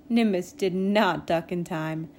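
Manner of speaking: usual speaking voice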